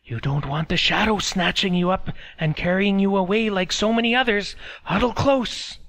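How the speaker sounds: high, loud creepy crone; spooky old woman warning; old woman whispering. A narrator's reading. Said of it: "an old woman whispered".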